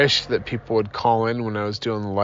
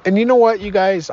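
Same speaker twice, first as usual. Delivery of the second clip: much higher and louder, bursting out to the listeners; excited aside; 10 semitones higher, 5 dB louder, faster than usual. Real, not acted. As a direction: perk up suddenly as if sharing a secret. Sudden lively turn to listeners.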